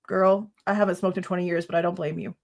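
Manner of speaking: ordinary delivery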